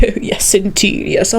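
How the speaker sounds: hushed posh English gent; mock upper-class British. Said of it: "the guys who were like".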